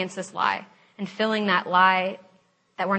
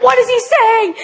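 high, loud indignant demand; mock outrage; 14 semitones higher, 11 dB louder than usual. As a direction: shout back as the alarmed ally. Roommate answers the alarm in kind.

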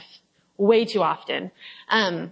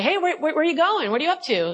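higher-pitched, hurried questioning; mock chasing-down questions, pitched up at first; urgent calling after; 8 semitones higher than usual. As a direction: call after someone getting away.

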